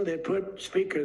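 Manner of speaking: usual speaking voice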